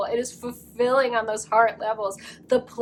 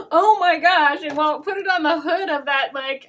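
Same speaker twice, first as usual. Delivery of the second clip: giggling through the words, higher; laughing; 6 semitones higher, 5 dB louder than usual. Real, not acted. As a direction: laugh while you gush. Laughs at her own joke.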